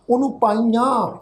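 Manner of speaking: normal talking voice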